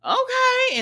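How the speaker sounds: high, drawn-out okay; a high, skeptical okay; sassy okay. Retold or imitated. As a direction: stretch it out, unimpressed. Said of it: "I said".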